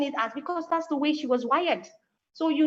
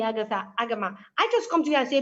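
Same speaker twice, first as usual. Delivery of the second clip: usual speaking voice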